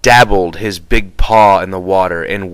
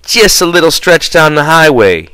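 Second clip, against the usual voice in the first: higher mock-casual imitation; mocking the driver; 7 semitones higher, 4 dB louder than usual. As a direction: parrot the driver scornfully. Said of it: "he says".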